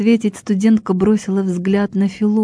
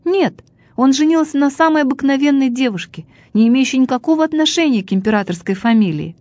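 higher-pitched, quickening blurt; 7 semitones higher than usual. A narrator's reading. Indignant student answers the old man, blurting it out. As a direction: blurt it out in one rush, pitched up. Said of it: "откровенно выпалила".

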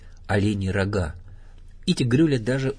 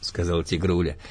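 ordinary delivery, nothing marked

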